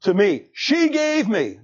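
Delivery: mock self-pitying Adam; Adam blaming. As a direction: play the excuse-making man. Adam blames the woman.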